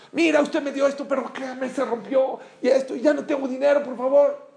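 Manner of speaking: súplica aguda y gritada de madre; gritos desesperados. Retold or imitated. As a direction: imita a una madre que ruega a gritos, muy agudo. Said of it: "empezó a gritar más". (súplica a gritos)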